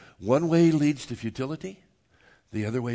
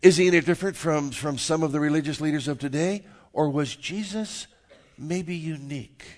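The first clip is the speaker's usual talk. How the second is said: raised pitch, deliberate questions left hanging; rhetorical preaching; 5 semitones higher, 6 dB louder than usual. Real, not acted. Pastor asking the congregation who Jesus is, rhetorical preaching. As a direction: press each question on the listeners, pausing between.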